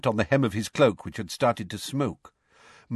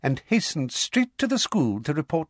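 high, triumphant account; self-satisfied, pitched up; Umbridge, smug; 8 semitones higher than usual. A narrator's reading. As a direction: score a point smugly. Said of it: "said Umbridge smugly".

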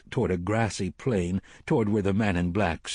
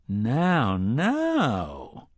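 amused chiding. A narrator's reading.

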